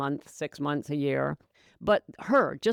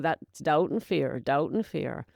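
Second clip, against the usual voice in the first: normal talking voice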